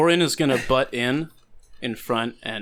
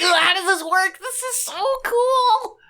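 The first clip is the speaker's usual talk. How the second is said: very high, excited squeal; squealing in-character delight; geeking out; 5 dB louder than usual. Acted. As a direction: gush like a thrilled fan, stretching the last words. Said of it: "immediately starts geeking out".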